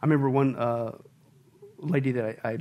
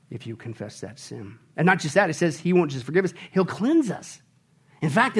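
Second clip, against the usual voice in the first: ordinary delivery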